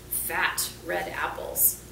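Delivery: ordinary delivery, nothing marked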